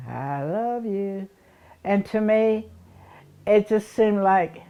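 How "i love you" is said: soft, distant, slow echo of the words. Retold or imitated. The echo returning the child's words, soft and far off. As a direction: fade it like an echo off the house. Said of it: "he would say".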